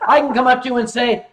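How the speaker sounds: ordinary talk, nothing marked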